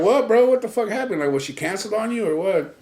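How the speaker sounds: curious mock pleading; excited. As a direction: press the friend to spill it. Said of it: "And we're like".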